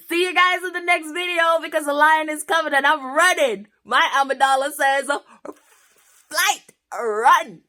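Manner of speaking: high, loud, hurried; running away from the lion, shouting goodbye; mock panic. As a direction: flee in comic panic. Joking escape from the lion.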